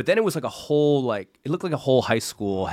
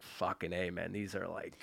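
much softer, low and awestruck; awed hush; 5 semitones lower, 17 dB quieter than usual. Retold or imitated. Tripping guy tells friend the chocolates are strong, awed hush. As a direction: breathe it out like the drugs just hit.

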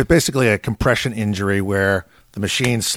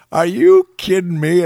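higher, louder exasperated disbelief; raised incredulous voice; 8 semitones higher, 5 dB louder than usual. Retold or imitated. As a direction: throw it up in mock despair. Retold disbelief at director arriving.

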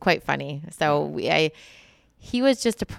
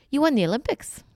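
a light, raised English accent, mimicking the prince; Prince Charles English accent; 9 semitones higher than usual. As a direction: quote him in a posh-sounding English accent, imitated by a Canadian. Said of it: "in his English accent".

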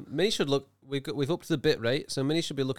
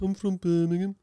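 put-on Birmingham accent; 7 semitones higher than usual. Puts on Birmingham speaker after naming it.

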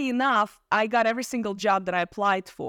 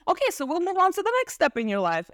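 higher-pitched, upbeat imitation of a father; positive father; 9 semitones higher than usual. Father urging daughter to move on, imitated positively. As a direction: lift the pitch, briskly moving things along. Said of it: "because he was a positive person".

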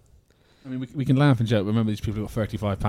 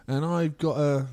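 show contestant voice; 6 semitones higher, slower than usual. Retold or imitated. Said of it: "You're gonna get".

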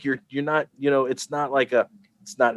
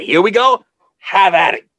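eager husband; 7 semitones higher, 6 dB louder than usual. Pretend.

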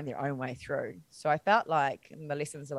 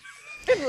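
high burst of laughter; 4 dB louder than usual. Real, not acted. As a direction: laugh at yourself in relief. Laughing at herself.

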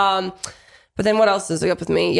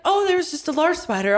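forced casual calm; 9 semitones higher than usual. Retold or imitated. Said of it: "I was like".

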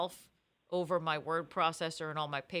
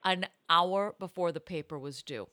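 ordinary talk

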